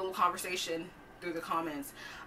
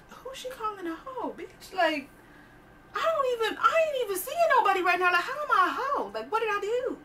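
very high, squeaky incredulous voice; high-pitched, hammed-up disbelief; baffled protest; 12 semitones higher than usual. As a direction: squeal it in mock bewilderment at being accused. Acts out baffled reaction to insult.

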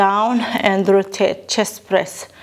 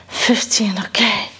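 breathless whisper; slower than usual. Real, not acted. Final rep, exhausted.

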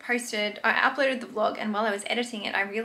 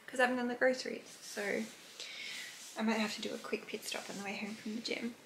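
softly whispered aside; 12 dB quieter than usual. Real, not acted. Planning a grocery stop after the gym, whispered. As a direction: whisper so no one hears. Quiet morning talk to camera.

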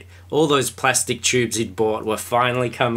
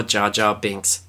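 usual speaking voice

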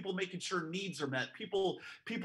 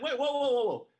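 startled, louder, higher whoas; surprised whoa; 5 semitones higher, 5 dB louder than usual. Retold or imitated. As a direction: pull her up short in alarm. Retold alarmed reaction.

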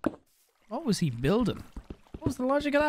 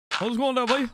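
put-on higher-pitched Irish country accent; 7 semitones higher, faster than usual. Showing how Nogla sounds more Irish, country accent. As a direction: imitate a country Irishman's greeting. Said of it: "a country accent".

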